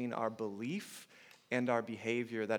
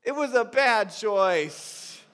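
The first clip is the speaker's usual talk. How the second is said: put-on tearful little girl; 9 semitones higher, 9 dB louder, much slower than usual. Father retelling his daughter's teary confession, mock tearful and high. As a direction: echo a little girl admitting it through tears. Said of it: "And through tears".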